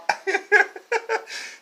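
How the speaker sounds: short high giggling laugh; laughter. Real, not acted. Vlogger enjoying the comedian's Epstein joke, high laughter.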